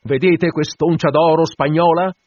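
loud, high call to the crew; captain rallying his men loudly. Acted. Captain addresses the assembled crew.

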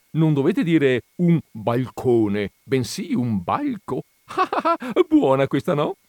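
high, slow punchline, then laughing at it; jovial exclamation. A narrator's reading. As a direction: deliver your own pun with jolly relish. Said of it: "esclamò giovialmente". (gioviale, con risata compiaciuta)

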